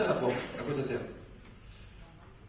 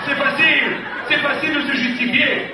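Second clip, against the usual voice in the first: much higher and louder, laughing; mock exasperated retort; 10 semitones higher, 9 dB louder than usual. Wife brushing off her husband's excuse, exasperated and loud. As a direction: throw it back as an exasperated wife.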